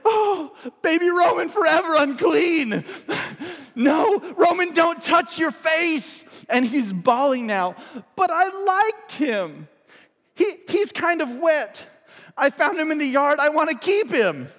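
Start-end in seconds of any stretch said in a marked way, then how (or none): 0.0-6.2 inner panic. Retold or imitated. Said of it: "Lauren in her head". 8.1-14.5 put-on high, whiny little boy pleading through tears; teary toddler begging mum to keep a dead mouse, high and whiny; crying toddler. Crying toddler voiced in story.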